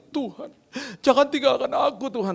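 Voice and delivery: high-pitched mock pleading prayer; hammed-up plea to God; intercessory prayer. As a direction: imitate someone desperately praying out loud. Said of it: "ada lagi doa-doa syafaat gini".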